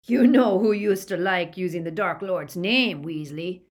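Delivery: loud, lightly growled menace; villain pushing the words out loudly. A narrator's reading. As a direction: growl a threat at the captive. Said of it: "growled Greyback".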